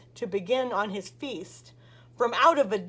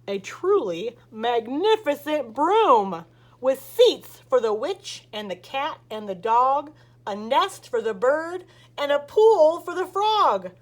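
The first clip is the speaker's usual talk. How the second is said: plain reading voice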